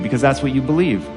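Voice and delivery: usual speaking voice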